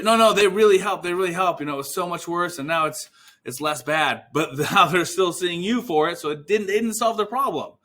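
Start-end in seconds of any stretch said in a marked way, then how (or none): 0.0-4.2 higher, louder eager protest; insistent, pitched up, easing off toward the end; role-played defensive patient. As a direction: jump in quickly to defend someone.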